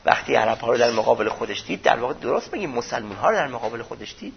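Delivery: ordinary delivery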